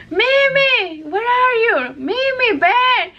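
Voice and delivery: calling out. Retold or imitated. Wife reenacting her search for the dog, high calling out. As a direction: call the dog's name across the dark. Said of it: "I said".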